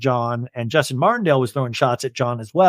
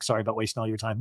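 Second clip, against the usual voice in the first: much softer, lower self-mocking "Sorry about wasting"; mock timid apology; 5 semitones lower, 10 dB quieter, much faster than usual. Shown as a demonstration. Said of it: "I was like".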